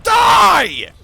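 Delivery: high, loud victory yells; triumphant shouting. Real, not acted. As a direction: shout each word as the hit lands. Cheering during boss kill.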